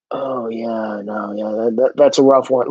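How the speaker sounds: mock sympathetic agreement. Mock agreeing with a crazy idea.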